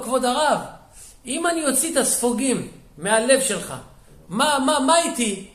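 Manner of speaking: high, loud, insistent retold plea; urgent pleading. Executioner begs the rabbi for a share, urgent and loud. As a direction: plead loudly, stammering on the question.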